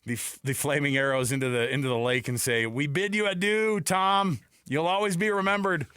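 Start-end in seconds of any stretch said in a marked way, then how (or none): 2.7-5.8 higher, slow, solemn send-off; hammed-up solemn farewell; mock farewell. Mock Viking funeral farewell.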